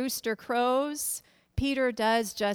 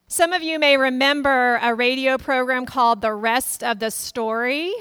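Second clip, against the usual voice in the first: ordinary delivery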